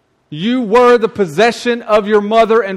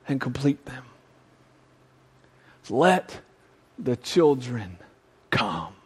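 tender welcome; 5 semitones lower, 9 dB quieter than usual. Acted. Jesus welcoming the children.

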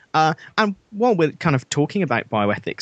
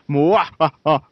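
evil cackle; 2 semitones higher, 3 dB louder, slower than usual. Acted. Villain cackles again.

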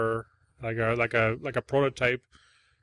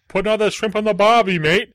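loud, much higher mock Australian accent; horrible Australian accent; 9 semitones higher, 10 dB louder than usual. Mocking Crocodile Dundee fans in a loud, high fake Australian accent. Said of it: "speaking in a horrible Australian accent".

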